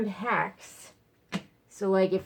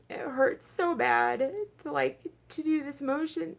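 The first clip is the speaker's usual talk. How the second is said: strained, higher voice close to crying; near tears from pain; 9 semitones higher than usual. Real, not acted. Talking to viewers about painful motion, near tears. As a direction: push through real pain, near tears. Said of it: "it's making me wanna cry".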